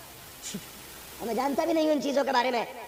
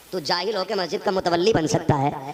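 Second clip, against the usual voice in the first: loud, rising rebuke; scornful preacher's retort; indignant retort; 3 semitones lower, 9 dB louder than usual. Retold or imitated. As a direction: throw the challenge back at them.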